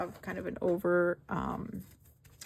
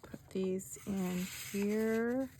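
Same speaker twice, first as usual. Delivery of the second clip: whisper; 6 dB quieter, slower than usual. Real, not acted. Quiet aside while working.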